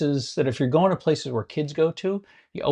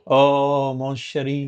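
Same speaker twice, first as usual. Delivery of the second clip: put-on French accent; much slower than usual. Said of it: "It's like Pepe Le Pew".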